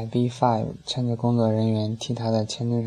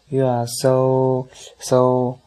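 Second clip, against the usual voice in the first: loud, slow, stretched-out call; wild yelling; 5 dB louder, much slower than usual. A narrator's reading. Fan yelling back at the star onstage, wild. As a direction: holler it, dragging out each "so". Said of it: "I B five狂叫".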